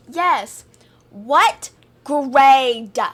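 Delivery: mock slow shout, word by word; exaggerated loud, drawn-out speech to a non-native speaker. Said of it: "I said slower, not louder".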